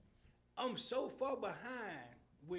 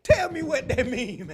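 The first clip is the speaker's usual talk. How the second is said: laughing ask; 4 semitones higher, 15 dB louder than usual. Retold or imitated. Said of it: "I'll say".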